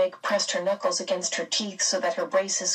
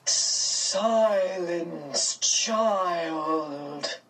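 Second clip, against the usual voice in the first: drawn-out, otherworldly command; formidable; much slower than usual. A narrator's reading. Mrs Which silencing Meg, formidable and eerie. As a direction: stretch each word into a rolling order. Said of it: "voice rolled formidably across the hall".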